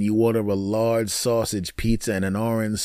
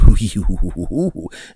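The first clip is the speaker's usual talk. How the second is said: quick comic hoo-hoo-hoo; mock lovemaking hoots; 3 semitones lower, 4 dB louder than usual. Comedian standing in for lovemaking, rapid hooting. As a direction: hoot rhythmically, as a euphemism.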